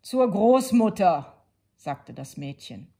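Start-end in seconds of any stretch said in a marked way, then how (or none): 0.0-1.5 put-on girl's voice. A narrator's reading. Girl answers the wolf.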